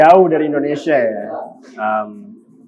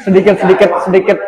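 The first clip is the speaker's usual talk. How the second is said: loud laughing repeat of sedikit; laughing tease; 5 semitones higher, 7 dB louder than usual. Real, not acted. Teasing repeat of her answer. (mengulang sambil tertawa)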